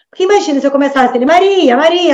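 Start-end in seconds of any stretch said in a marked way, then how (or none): 1.2-2.1 high, raised sing-out of a name; mock calling out. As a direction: pretend to hail a patient by name. Imagines addressing patient by name.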